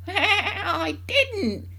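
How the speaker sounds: put-on high old-woman cackle into the words; cackle, then crow it high; cackling. A narrator's reading. Grandma on the roof answering the mother, cackling. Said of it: "cackled the old woman".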